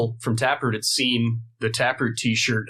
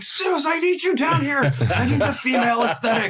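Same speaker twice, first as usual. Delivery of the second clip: high, loud call up the stairs; 3 dB louder than usual. Retold or imitated. Calling his wife down to the basement, loud call. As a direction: shout up to Suze.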